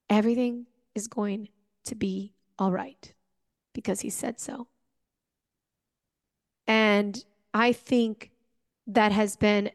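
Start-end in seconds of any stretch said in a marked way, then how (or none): 0.1-4.7 softer, fading into a hush; soft self-reassurance. Real, not acted. Repeating his promise to herself when afraid, softly. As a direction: murmur it like a private mantra.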